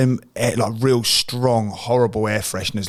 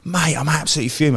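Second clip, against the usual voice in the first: higher-pitched imitation of a fuming mate; mock indignation; 8 semitones higher than usual. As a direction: protest in a high, put-out voice. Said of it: "he was like".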